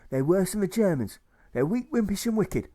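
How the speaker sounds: high-pitched railing; high, indignant rant; scornful bandit. Acted. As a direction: denounce them in a high comic voice. Bandit rails at pacifists.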